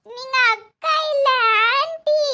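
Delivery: put-on slow kid correction, rising on the last word; five-year-old child. Child insisting she is auntie, childlike. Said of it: "அஞ்சு வயசு குழந்தைதான்".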